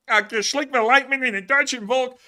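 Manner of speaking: Kermit voice. Pretend. Host reads a viewer's fake German in a hammed-up Kermit voice. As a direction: croon it like a puppet frog faking German. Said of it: "That was Kermit".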